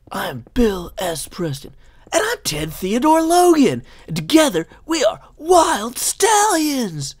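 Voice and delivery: high, loud slacker dudes introducing themselves; hammed-up rocker-dude proclamation; Bill and Ted. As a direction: shout it with long drawn-out words. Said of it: "Bill and Ted, like".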